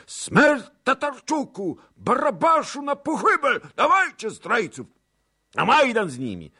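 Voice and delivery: loud, high-pitched baying for blood; drunken mob shouting. A narrator's reading. Drunken crowd demands death for the traitors, shouting. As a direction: scream it like a drunken lynch mob. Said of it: "krzyczały pijane głosy".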